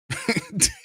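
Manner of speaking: very high mocking laugh; shrill disbelieving giggle; high cackle. Real, not acted. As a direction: burst into a squealing laugh.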